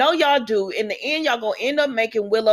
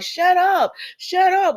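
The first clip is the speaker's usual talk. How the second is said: mock outburst; 10 semitones higher, much slower than usual.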